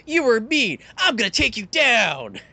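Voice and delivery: high, loud, hammed-up indignation; mock angry outburst. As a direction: declare war like a cartoon villain. Acted superhero turns against hero.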